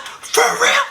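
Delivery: high, sharp mock-outraged question; acted incredulous outburst; retold angry disbelief. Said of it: "then I got angry".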